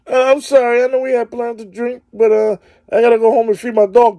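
high put-on girly voice making a lame excuse; mock girl's excuse. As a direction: pitch it up like a girl weaseling out of plans. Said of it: "this bitch could come to me".

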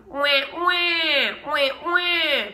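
put-on high loud wailing baby wah; baby cry. Shown as a demonstration. Teacher demos a baby cry sound. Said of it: "sounds like the baby cry".

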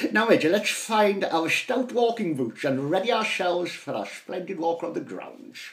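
rally the family for the walk; hammed-up aristocratic cheer; hearty posh father.

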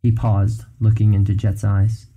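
usual speaking voice

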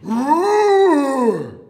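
huge high, loud roar; lion roar. Pretend. Playing lion with the children, huge loud roar. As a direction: roar biggest, rising and falling. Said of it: "give a roar".

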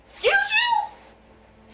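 stretched high outraged "excuse you"; outraged disbelief. Real, not acted. Reacting to the principal, high stretched outrage. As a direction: drag it out, high and indignant.

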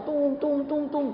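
high ringing "tung" calls; jungle hollering imitation. Mocking shouting at home, jungle-like calls. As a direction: hoot like echoes in a forest. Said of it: "kayak di hutan".

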